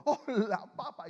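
high, loud gushing hello; emotional greeting. Retold or imitated. Preacher imitating an overly emotional hugger, high loud gushing greeting. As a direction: gush the greeting like a huggy relative. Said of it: "que cuando lo ven a uno".

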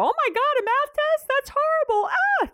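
shrieking high overreaction; hammed-up alarm, way up high; mock panic. Shown as a demonstration. Parent escalating over a math test, shrieking panic. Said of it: "you don't wanna go".

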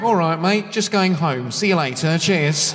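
put-on Southern English accent. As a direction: Scot faking an English accent to get past the border. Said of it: "I'm practising the accent".

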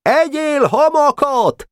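very high, stretched taunt; heated shout. A narrator's reading. Barabás pelting Pásztor with sand, heated shout. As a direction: cry out high and slow, fired up. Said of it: "kiáltott neki hevülten Barabás".

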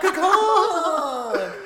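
loud, laughing "Ka-conk"; heavy clunk. Shown as a demonstration. Repeating the landing sound.

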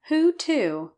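high, loud eager question. Acted. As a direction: burst out with curiosity. Eager question about the news.

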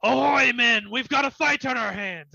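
high, loud rallying call to the crew; bellowing captain. Acted. Captain calling his crew to a fight from outside the bar, bellowing. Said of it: "you hear his voice bellow".

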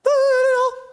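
high loud burst of laughter; shrieking laugh. Real, not acted. Laughs at the idea.

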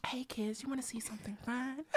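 unvoiced, hushed lure; creepy whisper. Teen friends joking, luring kids in a creepy whisper. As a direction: whisper it like a sly stranger.

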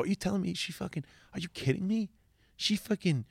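high-pitched, fast incredulous outrage; mock outraged reader. Online reader fuming over the court case, fast high outrage. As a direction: play the indignant reader who can't believe it. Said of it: "and go".

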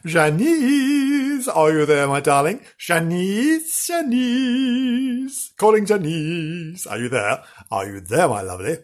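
high, drawn-out mystic calling; medium calling. Pretend. Host summoning Janice like a seance medium, high and drawn out. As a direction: call out across the ether.

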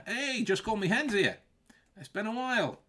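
high-pitched Scottish greeting; playful, lifted Scottish accent. Pretend. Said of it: "in a Scotch accent".

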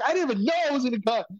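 high, fast mock disbelief; rapid, high-pitched retold surprise; amazed. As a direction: blurt it out, acting astonished. Acted amazed reply on the call.

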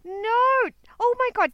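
shocked exclamation. Real, not acted. Listener reacting.

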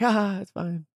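high, airy brush-off; dismissive. Retold or imitated. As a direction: shrug it off lightly.

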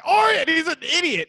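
high-pitched mock cartoon exclamation; Groundskeeper Willie. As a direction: throw it out as a heated cartoon parody. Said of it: "Groundskeeper Willie is a cartoon".

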